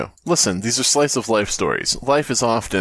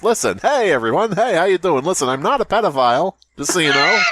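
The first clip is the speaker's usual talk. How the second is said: vaudeville comic; 7 semitones higher than usual. Retold or imitated. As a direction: work the room like an old vaudeville comic. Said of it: "Guy comes out on stage".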